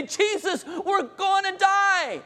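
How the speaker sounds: high-pitched panicked shout, drawn out; panicked cry. Retold or imitated. Disciples waking Jesus in the storm, panicked cry. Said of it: "They are panicked".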